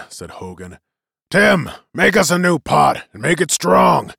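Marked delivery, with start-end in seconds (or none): 1.3-4.1 loud, raised captain's command; called-out order. A narrator's reading. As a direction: call it across the deck to a crewman. Captain calls order to crewman.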